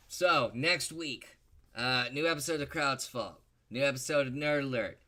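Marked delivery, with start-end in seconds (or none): none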